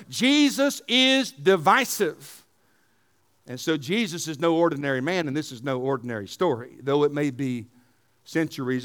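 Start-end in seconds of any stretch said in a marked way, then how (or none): 0.1-2.4 very slow, each word laid down deliberately; slow emphasis. Acted. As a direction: spell it out slowly so it lands. Slow emphatic sermon line.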